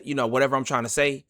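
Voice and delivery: ordinary delivery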